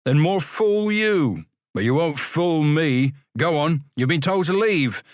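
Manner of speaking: a touch of a sneering porter; stern refusal. Acted. Porter Ferriby refusing the boys, mildly scornful.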